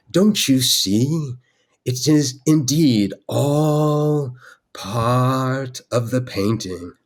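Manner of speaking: very slow, sustained mock incantation; wizardly blessing. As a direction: stretch it out grandly as a wizard's blessing. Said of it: "a wizardly blessing over it".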